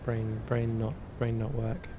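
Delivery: ordinary talk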